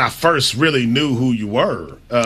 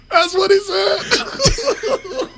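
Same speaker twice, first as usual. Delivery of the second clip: said through laughter; high, drawn-out laughing line; much slower than usual. Real, not acted. Laughing after impression.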